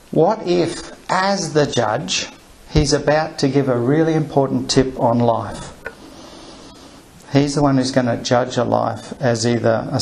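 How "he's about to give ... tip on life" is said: ordinary talk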